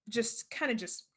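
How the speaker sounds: normal talking voice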